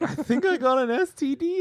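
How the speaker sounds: high, worried mock voice; high panicked friend. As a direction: squeak it like a scared kid. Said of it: "and he's like".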